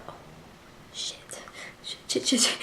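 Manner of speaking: hushed, breathless string of swears; panicked swearing. Retold or imitated. Reader fearing for the heroine, hushed panicked swearing. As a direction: mutter the curses under your breath in dread. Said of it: "I was like".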